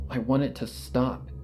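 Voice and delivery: plain reading voice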